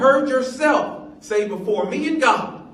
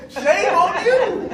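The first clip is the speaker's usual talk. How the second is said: mock scolding; 10 semitones higher, 6 dB louder, much slower than usual. Scolding with laughter.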